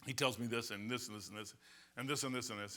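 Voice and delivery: normal talking voice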